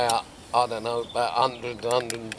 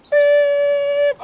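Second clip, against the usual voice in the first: very high, loud beeping sound; sustained mock electronic beep; bite alarm beep; 11 dB louder than usual. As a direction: imitate the alarm screaming off. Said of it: "About ten minutes later".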